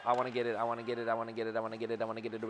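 low, soft repeated chant; rapid muttering. Real, not acted. Player chasing the puck, low eager muttering. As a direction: mutter it over and over under the breath.

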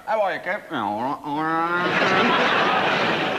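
slow drawled "all right, all right"; lazy mate mumbling a greeting; imitating Kev. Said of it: "and he's like that".

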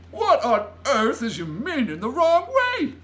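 loud, high giant question; big exclaiming giant voice; incredulous giant. A narrator's reading. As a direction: demand it in utter bafflement. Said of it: "asked the B F G".

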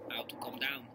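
soft unvoiced whisper. Real, not acted. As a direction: whisper so the cat isn't disturbed. Whispering near the cat.

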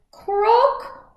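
high, loud croaking frog call; a big froggy croak for the kids. Acted. Reading jungle animal sounds aloud.

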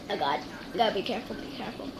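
hushed, fast and careful; tense whisper. Real, not acted. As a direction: whisper it under your breath. Balancing on the edge.

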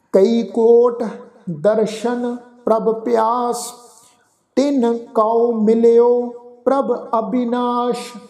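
slow, measured recitation; slow gurbani recitation. Acted. Teacher reciting gurbani verse slowly to students. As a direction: recite each word apart, devoutly.

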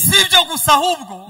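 loud, rising sermon cry; fiery preacher proclaiming; preaching at full force. Acted. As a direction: preach it out hard to the congregation. Preacher building to a climax.

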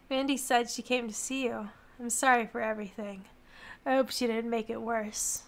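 soft, low apology; quietly regretful message; subdued apologetic character. Acted. Absent lover texts an apology, low and subdued.

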